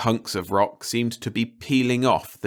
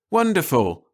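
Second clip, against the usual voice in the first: higher and louder delight; enthused; 9 semitones higher, 5 dB louder than usual. Acted. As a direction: cry it out with glee. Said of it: "the scientists enthused".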